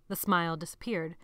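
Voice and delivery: ordinary delivery, nothing marked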